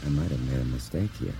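low, soft, understated realisation; private thought. Acted. Albert looks into the muzzle and doubts himself, quiet thought. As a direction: think it aloud under your voice. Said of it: "he thought".

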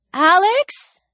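high, questioning call; girl's distant, rising query; far-away voice. A narrator's reading. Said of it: "Ellie's voice sounded very far away".

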